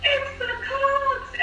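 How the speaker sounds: very high, drawn-out retold cry of joy; elated announcement. Parent reliving telling him the team, elated. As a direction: re-enact breaking thrilling news, stretching it high. Retells breaking the joyful draft news.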